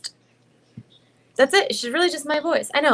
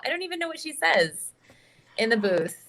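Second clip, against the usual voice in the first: ordinary talk, nothing marked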